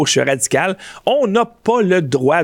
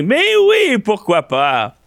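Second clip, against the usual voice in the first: sarcastic; 6 semitones higher, slower than usual. Real, not acted. Sarcastic agreement with headline.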